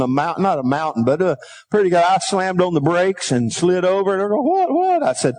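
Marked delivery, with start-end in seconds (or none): 4.4-5.0 high startled what what; mock startled double take; surprised. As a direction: yelp it in surprise. Retelling surprise on the road.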